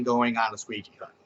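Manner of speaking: low soft dull mumble; flat no-motions version. Shown as a demonstration. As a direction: say it limp and bored. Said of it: "what's the fun of just going".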